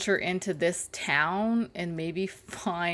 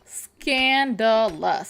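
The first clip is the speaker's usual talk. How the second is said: drawn-out mock shock; 4 semitones higher, 8 dB louder, much slower than usual.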